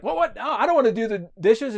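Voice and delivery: put-on high, loud, hurried boy's protest; protesting kid. Johnny volunteered for dishes, high flustered protest. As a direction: object like a kid caught off guard.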